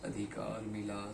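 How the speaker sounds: low, slow and soft; subdued admission. Acted. Confessing hollow success to Mallika, subdued admission. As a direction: say it quietly, drawn out. Listing hollow rewards in monologue.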